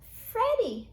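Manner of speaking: out of breath from running, high; breathless call after the run; panting. A narrator's reading. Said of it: "Christopher panted".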